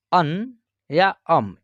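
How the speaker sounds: अन या अम spelled out slowly, each nasal ending clear; slow nasal vowel demo. Shown as a demonstration. Teacher slowly modelling the dotted vowel for beginners. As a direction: slowly sound out अन and then अम. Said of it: "अ के ऊपर जो है एक बिंदी है".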